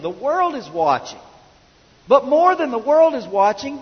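high, insistent sermon delivery; impassioned preaching. Real, not acted. Preacher urging church to honor the Father, impassioned. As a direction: drive each line home from the pulpit.

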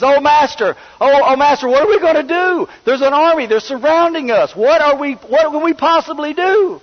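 high, loud panicked cry to his master; panicked servant. Retold or imitated. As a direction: blurt it out in a hammed-up panic. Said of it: "he's panicked".

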